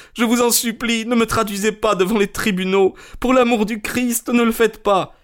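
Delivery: high-pitched, desperate begging; acted frantic supplication; pleading cry. A narrator's reading. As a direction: beg for mercy in a high, panicked voice. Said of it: "cria-t-il".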